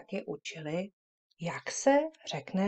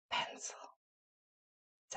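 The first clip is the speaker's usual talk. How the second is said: whispered; 13 dB quieter than usual. Shown as a demonstration. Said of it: "pošeptáme, very softly".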